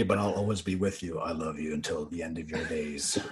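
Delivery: soft, low, tender reading; mock love note. Shipmates' prank note to Eeyore, low mock-tender reading. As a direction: sink into a hushed mock-romantic voice. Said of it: "We wrote a note and it said".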